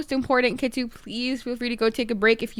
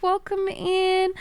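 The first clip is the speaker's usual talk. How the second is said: normal talking voice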